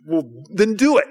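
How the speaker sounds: mock impatient dare; pushy goading. Brother pressing a guest to open beer, pushy.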